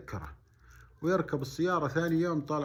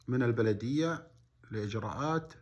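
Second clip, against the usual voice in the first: ordinary talk, nothing marked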